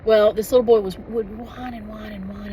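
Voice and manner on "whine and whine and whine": mock whining. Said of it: "when it comes to the whining voice".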